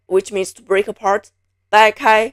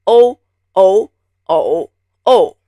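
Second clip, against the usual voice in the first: "O" clipped short and clean; short and crisp; 4 dB louder, slower than usual. Shown as a demonstration. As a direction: say "O" crisp, no drawn glide. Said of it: "short and crisp".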